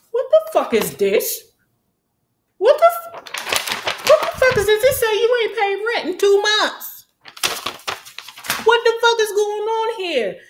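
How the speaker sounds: put-on a girl shocked by an eviction letter; outraged Candace. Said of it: "found an eviction letter and was like".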